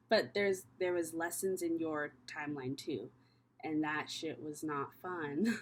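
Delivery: soft, low, unhurried comfort for a scared listener; soothing reassurance. Real, not acted. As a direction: ease them through it gently, like a calm guide.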